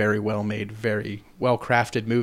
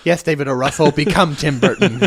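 laughing while talking; chuckling through it; 5 semitones higher, 6 dB louder than usual. Real, not acted. Laughing at the joke.

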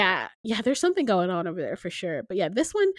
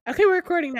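excited shout; 8 semitones higher, 8 dB louder, faster than usual. Real, not acted. Announcing recording restarted.

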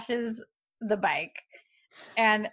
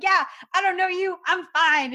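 mock casual denial; 8 semitones higher than usual. She trolls him.

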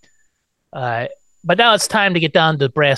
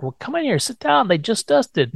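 much higher mock host voice; a chirpy put-on welcome, pitched up; imitating the dad; 9 semitones higher than usual. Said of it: "he's like".

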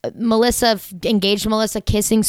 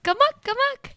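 sing-song amok. Shown as a demonstration.

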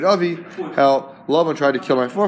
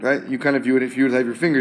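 ordinary delivery

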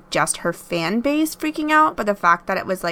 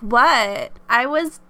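drawn-out, higher-pitched mock excuse; mock flippant excuse; 3 semitones higher, much slower than usual. Streamer brushing off worried followers, mock flippant. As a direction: imitate a put-upon streamer, stretching the words.

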